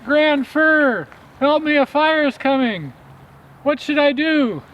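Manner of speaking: high, loud frantic mouse cry; a tiny mouse shouting up a tree for help; panicked little mouse calling up high; little mouse yelling. A narrator's reading. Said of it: "yelled up into the branches".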